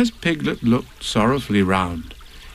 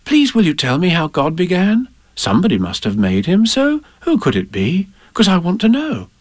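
put-on higher, eager little girl asking; pleading child; 7 semitones higher than usual. A narrator's reading. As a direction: tug at Nan's sleeve. Child Ann begs her Nan.

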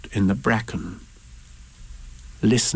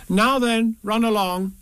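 higher motherly send-off; Kanga; 11 semitones higher, slower than usual. A narrator's reading. Kanga sending the boisterous pair out, high motherly voice. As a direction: shoo them in a raised voice. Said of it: "And Kanga said".

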